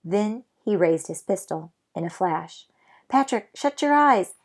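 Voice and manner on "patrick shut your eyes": urgent warning shout. Acted. Omri warns before the shot.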